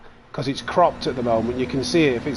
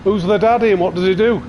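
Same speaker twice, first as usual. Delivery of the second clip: higher, louder Arnie quote; Arnie impression; 5 semitones higher, 5 dB louder than usual. Streamer digs up another Arnie line, higher and louder. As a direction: punch it out like Kindergarten Cop. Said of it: "Trying to think of other".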